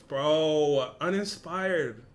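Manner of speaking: ordinary delivery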